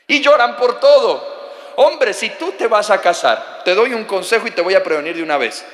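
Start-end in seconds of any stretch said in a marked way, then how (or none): none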